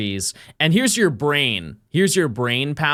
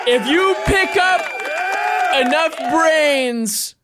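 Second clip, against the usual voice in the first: excited shouting; 12 semitones higher, 4 dB louder, slower than usual. Real, not acted. Repeating game voice line.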